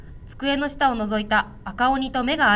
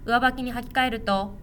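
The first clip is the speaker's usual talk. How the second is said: ordinary delivery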